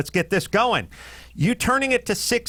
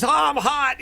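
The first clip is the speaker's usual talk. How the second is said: mocking wife; 11 semitones higher than usual. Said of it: "she says".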